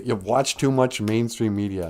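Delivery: normal talking voice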